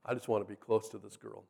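low, quiet inner voice; private thinking. Retold or imitated. Jacob privately thinking about the girl, quietly. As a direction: think it aloud, soft and low. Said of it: "He is already thinking, processing".

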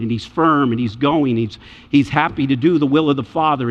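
ordinary delivery

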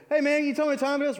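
rapid, high, loud blurt; eager jogger. Pretend. Second jogger pestering the driver, high and rapid. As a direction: rattle it off twice as fast, bouncing.

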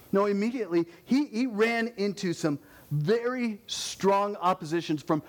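ordinary talk, nothing marked

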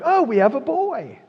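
high, loud, delighted birth announcement; mock surprise.

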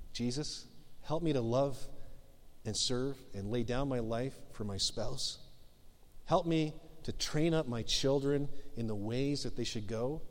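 soft, low, earnest prayer; hushed sincere prayer; quiet prayer. Shown as a demonstration. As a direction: pray it quietly, like a personal plea. Voicing a sample prayer.

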